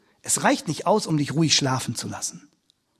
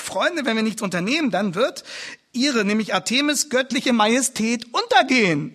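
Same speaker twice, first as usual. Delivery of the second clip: mildly worried, higher and louder; hammed-up concern with raised pitch; 6 semitones higher, 5 dB louder than usual. Demetrius warns his friends about the goddess, acted worry. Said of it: "da sagt er ganz besorgt".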